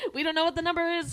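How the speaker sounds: high, fast, laughing through words. Real, not acted. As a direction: giggle while talking. Laughing at the recorded message.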